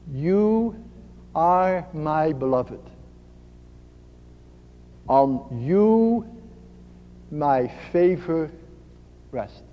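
slow, tender and low, pausing between phrases; soft, gentle voice. Acted. God gently naming the listener beloved, soft and slow. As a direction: let each phrase settle softly and unhurriedly. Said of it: "is a very soft voice, a gentle voice".

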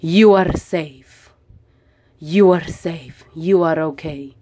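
put-on slow, soothing English in an Italian accent; calm her down slowly, Italian accent. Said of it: "con un acento muy italiano". (acento italiano lento y tranquilizador)

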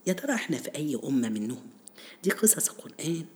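ordinary talk, nothing marked

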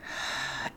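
soft, voiceless sigh out; demonstrated exhale. Shown as a demonstration. Guide showing listeners how to exhale, soft sigh. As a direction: breathe out softly through the mouth. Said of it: "Exhale out of your mouth".

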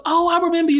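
put-on very high gushing older lady voice; high imitated older lady. Stranger at party gushing to him, very high mock voice. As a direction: squeal it like an excited stranger.

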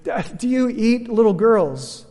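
put-on a scared child asking the lion; fearful question. A narrator's reading. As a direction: stammer it out in fear. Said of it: "she asked fearfully".

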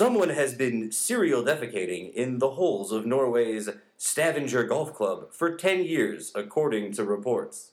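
slow, measured newscaster read; deliberate anchor-style phrasing; news voice. Acted. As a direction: deliver it like an evening news anchor. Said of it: "you gotta do a different paragraph".

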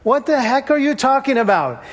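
high, baffled outburst as Thomas; bewildered Thomas. Retold or imitated. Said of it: "Thomas stops and goes".

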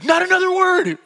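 high, loud hysterical order; coming unraveled. Retold or imitated. Unraveled driver silencing his back-seat friend, frantic yell. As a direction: shut your friend up in exaggerated panic. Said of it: "Mark's coming unraveled".

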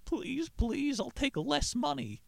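high pleading whine, mock-desperate; hammed-up high begging for his job. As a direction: plead way up high like a cornered old salesman. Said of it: "He's begging".

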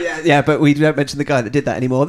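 normal talking voice

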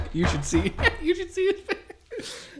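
high laughing fit; laughing hard. Real, not acted. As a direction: laugh through the words. Laughing at partner's face.

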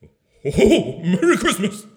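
surprised Santa. Acted. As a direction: caught off guard mid-greeting. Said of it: "Maybe surprised".

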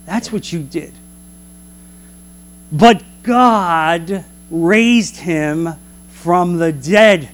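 ordinary talk

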